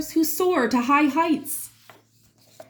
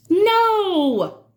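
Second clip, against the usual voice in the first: emphatic no; 7 semitones higher, 3 dB louder than usual. A narrator's reading. Firm interruption in story.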